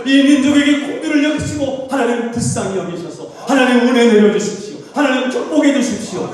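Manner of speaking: high, fervent pleading prayer; crying out in prayer. Acted. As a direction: beg God for mercy at full force. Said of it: "눈물 흘리고 통곡하고".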